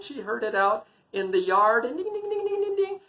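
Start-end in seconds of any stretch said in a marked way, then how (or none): 2.0-3.0 very high-pitched tinkling imitation; jingling ankle bells. Bells heard out in the yard, high tinkling jingle. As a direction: run the dings together like tiny bells.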